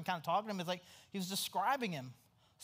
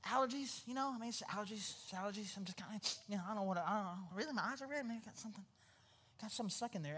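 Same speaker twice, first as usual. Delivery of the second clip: sheepish excuse; 5 dB quieter, much faster than usual. Retold or imitated. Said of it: "It's like".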